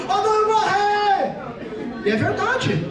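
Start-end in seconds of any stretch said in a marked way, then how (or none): 0.1-1.3 high, slow panicked wail; hammed-up fear of dying; mock desperate terror. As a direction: cry out as if clinging for life. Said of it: "Se agarrando um outro assim".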